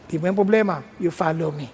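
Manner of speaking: low, unhurried divine answer; God's calm reply. Acted. God answering a worried future minister, low calm authority. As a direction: drop low and settle the matter.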